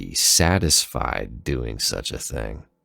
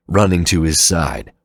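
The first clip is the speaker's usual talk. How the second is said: ordinary delivery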